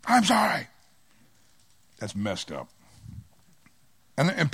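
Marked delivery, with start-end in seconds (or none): 0.0-0.7 fake sincere sorry. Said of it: "Just say it like I mean it".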